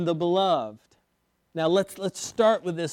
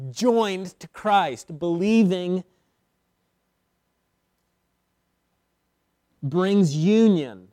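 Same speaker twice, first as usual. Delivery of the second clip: slow and louder on union; deliberate preacher's emphasis; slow emphatic climax; 6 dB louder, slower than usual. Acted. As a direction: press each word home.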